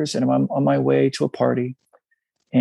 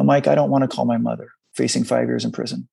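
normal talking voice

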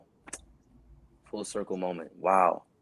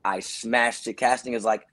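ordinary delivery

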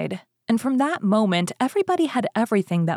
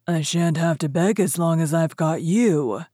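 pleased ogre. A narrator's reading. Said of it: "laughed the ogre".

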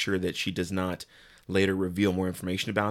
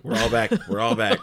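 laughing; 6 dB louder than usual. Real, not acted. Joking back after mixing up actors.

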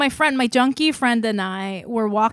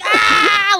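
high, loud "ah" shriek; imitated helpless laughter; 10 semitones higher, 7 dB louder than usual. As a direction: mimic a friend shrieking with laughter. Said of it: "she's laughing so hard".

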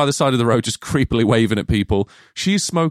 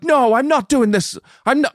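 put-on Irish accent, higher and indignant; 14 semitones higher than usual. Retelling Siobhan's refusal in an Irish accent. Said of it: "I'm gonna stop doing the accent".

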